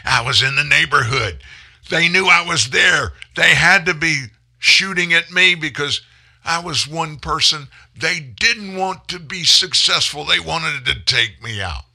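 loud, high mocking Biden voice; mock Biden quote. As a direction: shout it like an indignant Biden. Mocking Biden's bullet story.

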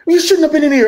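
very high imitation of a scolding shopkeeper; acted-out indignant reprimand; mock scolding. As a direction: tell off an intruder in a high voice. Shopkeeper scolds him in retold story.

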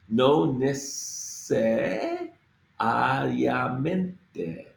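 No necesariamente stretched very slowly, each letter sounded; very slow, every letter. Shown as a demonstration. Teacher sounding out necesariamente very slowly. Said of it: "We have to get through this whole, all the letters here".

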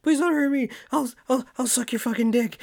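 high, loud, fast panicked begging; frightened prey animal. Retold or imitated. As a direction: plead in high-pitched terror. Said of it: "he turns into a bitch".

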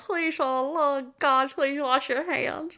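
imitating a desperate plea with mouth held open; open-mouthed pleading. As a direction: plead as if unable to close your mouth. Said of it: "opens his mouth and he's like".

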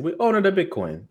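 a joking, badly done Italian accent. Shown as a demonstration. Mock Italian accent for cohosts to laugh at. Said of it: "it's a bad Italian accent".

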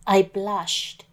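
usual speaking voice

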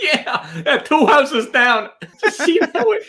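laughing through the words, slow and high. Real, not acted. Laughing at his own highway joke to his cohost. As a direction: chuckle out the punchline.

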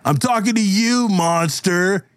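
high-pitched confrontational heckling; hostile bully. Acted. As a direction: lay into the monster, accusing. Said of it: "he actually yells at the".

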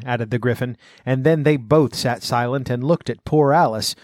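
ordinary delivery, nothing marked

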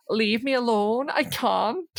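mock distressed Orla. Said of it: "she's basically just like".

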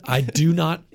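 usual speaking voice

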